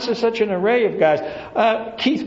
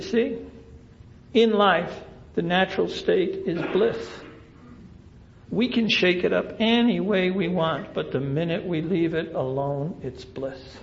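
softer and lower until almost nothing; a calm teaching voice dropping to a hush; calm fading bliss; 5 semitones lower, 6 dB quieter than usual. Retold or imitated. Calm lesson, fading soft.

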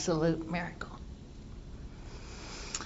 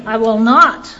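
defiant refusal; 10 dB louder than usual. Retold or imitated. Sponsee's defiant refusal quoted.

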